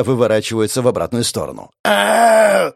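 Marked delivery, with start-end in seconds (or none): 1.8-2.7 high, drawn-out yell of pain; man's pained wail. A narrator's reading. Man whose knee just snapped, wailing. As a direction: wail out in agony. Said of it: "завопил мужик".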